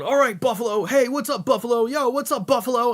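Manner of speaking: high, loud repeated mock hype; hammed-up rock-star crowd greeting; stage shout-out. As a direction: greet the crowd over and over like a frontman. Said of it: "he just keeps going".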